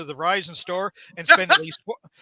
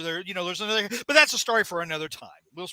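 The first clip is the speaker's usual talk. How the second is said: normal talking voice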